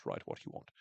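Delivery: low, quiet mock grumble of write what you want; muttered aside. Shown as a demonstration. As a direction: drop it to a grumble to yourself.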